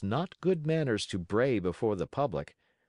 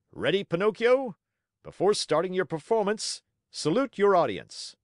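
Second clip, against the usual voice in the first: high, loud showman's order; projected circus-master voice; ringmaster's command; 9 semitones higher, 5 dB louder than usual. Acted. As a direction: call out to your performing donkey for the crowd. Ringmaster commands donkey before crowd.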